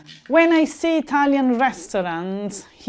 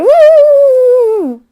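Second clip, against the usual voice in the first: sudden high, loud whoop; 13 dB louder than usual. Retold or imitated. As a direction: let out a wild cheer of chaos. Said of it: "it's like".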